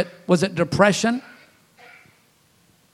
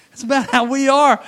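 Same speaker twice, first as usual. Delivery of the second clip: ordinary talk, nothing marked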